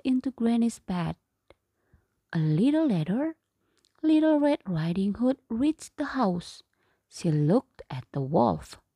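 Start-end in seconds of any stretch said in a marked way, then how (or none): none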